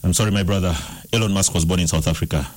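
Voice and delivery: low, deflated disappointment; disappointed. Real, not acted. Quiz host to losing caller about unwon money, disappointed. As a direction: drop the pitch right down, let down.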